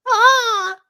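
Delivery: sudden high yelp of pain; short shrill yelp; pained cry. Acted. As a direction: cry out like you've been hit. Pained cry from the story read aloud.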